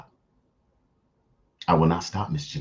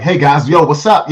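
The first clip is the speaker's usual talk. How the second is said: high, louder, peppy fake greeting; put-on higher voice; 6 semitones higher, 6 dB louder than usual. Shown as a demonstration. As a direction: demonstrate the presentable voice, pitched up and louder. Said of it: "I had been talking like this".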